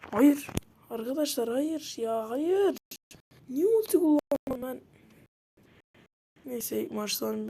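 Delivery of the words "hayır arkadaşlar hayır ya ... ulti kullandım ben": high loud cry of no, then a slow wail; anguished shout. Real, not acted. Gamer wailing at viewers after wasting his ultimate. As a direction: howl no as the match slips away. Dying in game, frustrated.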